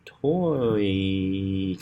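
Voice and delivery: elongated syllable "toy"; drawn-out TOY. Shown as a demonstration. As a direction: stretch "toy" slowly. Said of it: "'cause that's stress".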